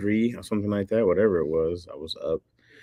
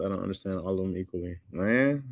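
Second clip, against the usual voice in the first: ordinary talk, nothing marked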